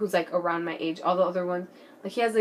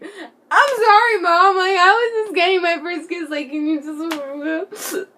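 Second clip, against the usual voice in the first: mock pleading; 9 semitones higher, 9 dB louder, slower than usual. Pleading apology to mom.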